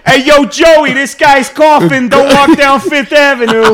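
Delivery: shouting New Yorker, high and slow; New York accent. Retold or imitated. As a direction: yell it down the street, Brooklyn style. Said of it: "do a New York accent".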